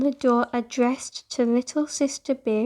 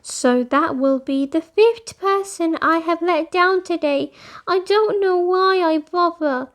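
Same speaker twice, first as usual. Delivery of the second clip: higher, louder defeated complaint; a little girl's frustrated self-pity; 5 semitones higher, 4 dB louder than usual. A narrator's reading. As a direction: vent your frustration, close to giving up. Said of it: "couldn't contain her frustration".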